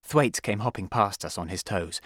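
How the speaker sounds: usual speaking voice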